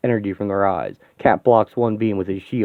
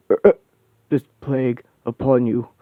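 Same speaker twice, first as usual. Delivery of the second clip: ordinary talk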